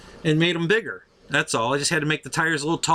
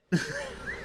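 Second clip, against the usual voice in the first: high laugh; 9 dB quieter than usual. Retold or imitated. Amazed driver laughing.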